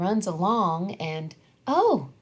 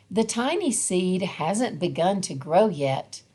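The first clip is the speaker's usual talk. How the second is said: usual speaking voice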